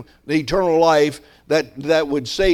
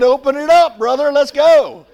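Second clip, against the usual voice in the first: higher and louder, rallying the brother; eager call; 10 semitones higher, 8 dB louder than usual. Retold or imitated. Pastor eagerly urging Reuben to start the study, higher and louder. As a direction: fire him up to open the Bible.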